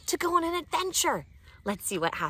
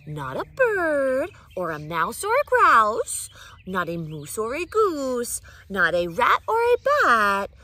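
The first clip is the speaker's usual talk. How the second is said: loud rhyming scold; 4 semitones higher, 9 dB louder than usual. Acted. Mother's rhyming list, swinging loud.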